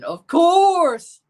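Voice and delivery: high, loud impression shout; hammed-up holler, COURSE held long; Cenk Uygur. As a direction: belt it out like an exclaiming pundit. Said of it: "my best Cenk Uygur impression".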